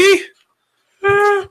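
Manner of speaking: sudden falsetto eh; high squeaky eh. Real, not acted. Thinking about husky.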